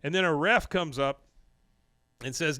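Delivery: usual speaking voice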